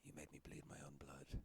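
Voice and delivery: unvoiced whispered threat. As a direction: hiss it under your breath as a fighter. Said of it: "Garrett says".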